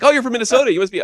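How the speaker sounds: mock excited greeting. To a Minnesota guy, mock eager high greeting. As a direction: gush at the Minnesotan, hamming it up. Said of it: "I was like".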